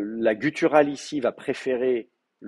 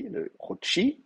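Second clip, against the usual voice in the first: Chouchi with the accent on the final i; Chouchi, final i stressed and higher; 9 semitones higher, 4 dB quieter, much slower than usual. Shown as a demonstration. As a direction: lean on the last syllable of Chouchi. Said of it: "le I va prendre l'accent".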